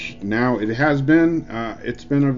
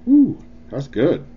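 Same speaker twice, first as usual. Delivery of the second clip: impressed ooh; 4 semitones higher, 4 dB louder, slower than usual. Retold or imitated.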